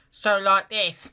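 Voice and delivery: slow, raspy cockney rasp; hoarse cockney child. Acted. The beggar girl trying a hoarse cockney rasp. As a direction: rasp it like a cold street child. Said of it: "Her voice was hoarse and raspy with the cold".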